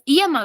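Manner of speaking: yema mispronounced as i-e-ma, opening on a vowel i. Shown as a demonstration. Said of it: "no decimos yema".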